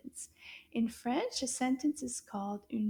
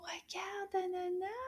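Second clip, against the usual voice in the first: whisper; 7 semitones higher, 6 dB quieter than usual. Acted. Hushed aside to the puppet.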